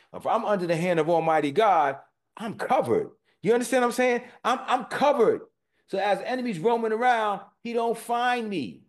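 rising, loud, high preaching; fervent preaching. Real, not acted. Pastor assuring the congregation he is covered, loud and high. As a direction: drive it home to the congregation.

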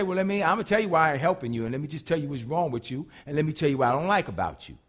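a quick, nagging lecture; mock faultfinding tirade; fast scolding rant. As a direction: rattle off the faults fast and low. Voicing a faultfinding God.

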